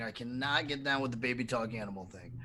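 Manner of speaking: ordinary talk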